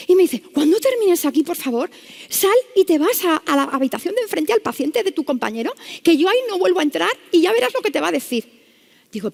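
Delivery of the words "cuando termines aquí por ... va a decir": anxious panic. Retold or imitated. Frightened nurse sends doctor to the other room, anxious panic. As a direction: imitate a rattled nurse urging someone out. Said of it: "con un ataque como de ansiedad".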